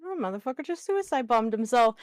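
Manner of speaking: ordinary talk, nothing marked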